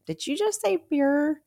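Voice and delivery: high incredulous question; mock shocked listener asking; surprised. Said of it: "if you're thinking".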